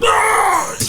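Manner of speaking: mock scream. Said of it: "I was like".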